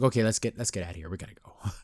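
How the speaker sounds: low, hushed mock escape plan; uneasy aside. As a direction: drop the voice as if slipping out unnoticed. Voices girl's uneasy exit.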